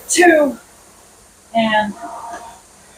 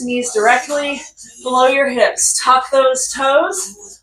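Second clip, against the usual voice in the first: usual speaking voice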